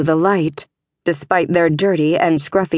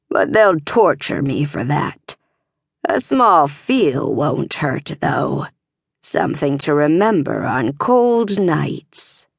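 grating stablemaster; 4 semitones lower than usual. A narrator's reading. Stablemaster to the trapped girl, low grating voice. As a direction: scrape the words out low and greedy. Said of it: "the man's voice grated".

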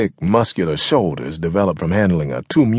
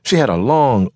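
ordinary delivery, nothing marked

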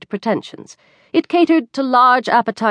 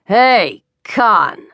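waiter's loud summons; short, loud shout across a diner; calling out; 2 semitones higher, 5 dB louder than usual. A narrator's reading. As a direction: call a colleague over, louder. Waiter calls out to a colleague.

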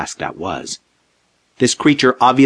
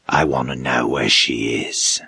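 low, slow menacing monster voice; deep male voice; 8 semitones lower than usual. A narrator's reading. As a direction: rumble it from the dark. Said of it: "a decidedly male voice from the deep".

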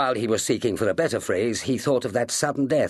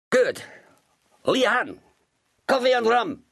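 Sullivan calling. A narrator's reading. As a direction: summon the cook for coffee and rum. Captain calls for rum.

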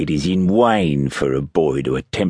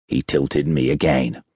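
ordinary delivery

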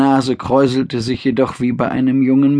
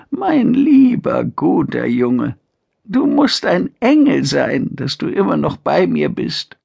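put-on frail old mother; 6 semitones higher than usual. Old mother praises her son.

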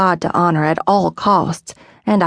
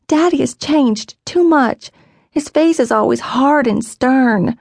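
put-on higher, slow voice of an upset young girl; girl's sad complaint; 7 semitones higher than usual. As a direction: let a little girl's grievance spill out slowly.

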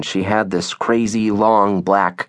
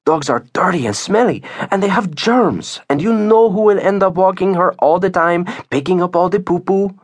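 high-pitched, emphatic scolding; disapproving Lourdes; 11 semitones higher than usual. Acted. Lourdes warning Isabel about dirty dogs, disapproving. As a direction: warn her off with high-voiced disgust. Voices Lourdes objecting to dog.

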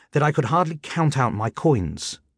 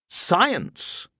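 high, scornful echo; scornful landlady; 7 semitones higher than usual. Acted. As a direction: sneer at the excuse.

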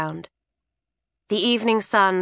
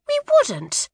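much higher, quick denial; girl's protest. A narrator's reading. Twin girl denying to her mother, high and quick. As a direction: squeak it out in protest.

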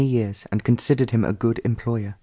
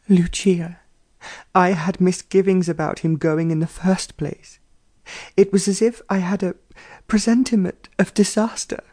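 higher fretful mother's voice; a delicate lady full of foreboding; frail distraught mother; 7 semitones higher than usual. A narrator's reading.

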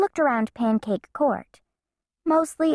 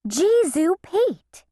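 higher, slow, exasperated cry; exasperated exclamation; 9 semitones higher, 3 dB louder, slower than usual. Acted. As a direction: blurt it out in frustration. Frustrated mom fixing wires.

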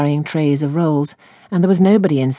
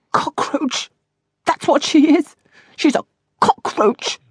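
high, scornful outburst, partly breathy; furious; 12 semitones higher than usual. A narrator's reading. Miss Moss alone after the quarrel, high furious scorn. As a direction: spit out the insult in a high voice.

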